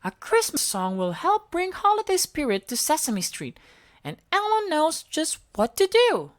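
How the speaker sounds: Elmo voice. A narrator's reading. Elmo answering Smudge, high and perky. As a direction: chirp it like a cheerful little monster.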